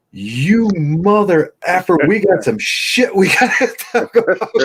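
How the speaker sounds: high, loud, slow mock outrage at the casket; mock funeral yell. Friend yelling at the casket as a joke. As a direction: holler at the dead friend, half laughing. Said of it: "and he goes".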